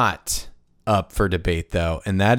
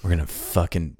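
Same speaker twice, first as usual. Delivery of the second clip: nineties villain; 5 semitones lower, 5 dB quieter than usual. Retold or imitated. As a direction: menace him quietly, savoring it. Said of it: "they just talk like this".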